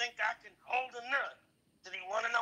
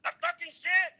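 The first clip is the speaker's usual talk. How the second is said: high-pitched mock Mexican accent; put-on Mexican character, comically cross; high, indignant Mexican caricature voice; irate Mexican character; 5 semitones higher than usual. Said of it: "Mexican away".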